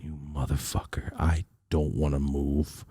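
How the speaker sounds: low, soft, slow seething; suppressed anger. Acted. Mad son riding silently beside dad, seething quietly. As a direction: hold the fury in, dragging it out low. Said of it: "I was mad".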